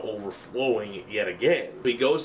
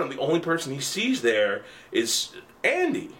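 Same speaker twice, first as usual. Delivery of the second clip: ordinary talk, nothing marked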